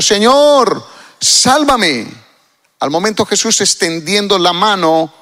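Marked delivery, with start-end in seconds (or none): 0.0-2.1 loud, high cry for help. Acted. Peter sinking in the water calls to Jesus, crying out. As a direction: call out for rescue, high and loud. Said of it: "dio voces diciendo".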